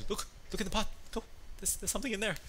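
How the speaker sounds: urgent wife. Retold or imitated. Wife urging husband to check the pot, urgent. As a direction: push him to look, a bit high-pitched. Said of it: "so she's like".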